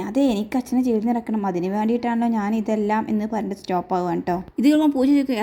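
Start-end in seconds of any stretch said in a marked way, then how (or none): none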